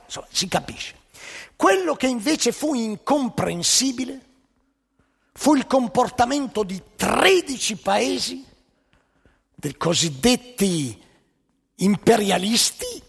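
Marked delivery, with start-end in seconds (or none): none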